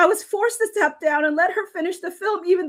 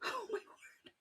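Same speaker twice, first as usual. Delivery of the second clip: whisper; 6 semitones higher, 23 dB quieter than usual. Real, not acted. Stunned after reading the letter.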